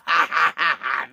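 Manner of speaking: mock-villain cackle; playful, drawn-out evil "ha-ha-ha"; evil laugh. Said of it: "that was my evil laugh".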